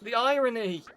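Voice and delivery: high, loud ironic outcry; exasperated exclamation. Acted. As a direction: throw it up in exasperation. Exasperated outburst at being tied up.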